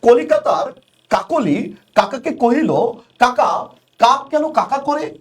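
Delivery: slow, the repeated ক sounds in "কলিকাতার কাকলি কাকাকে" each said crisply; tongue twister read slowly to show the ক sound; slow alliteration demo. Shown as a demonstration. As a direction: spell out every ক in "কাকা, কাক কেন কাকা করে" slowly. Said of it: "যেমন আমি একটা উদাহরণ প্রায় স্টুডেন্টের বলি".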